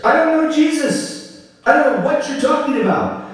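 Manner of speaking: high, fast protest; Peter swearing denial. Acted. Peter denying Jesus to the servant girl, high protest. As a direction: swear it off in a panic. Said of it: "And Peter swears".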